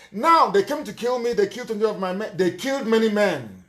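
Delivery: ordinary talk, nothing marked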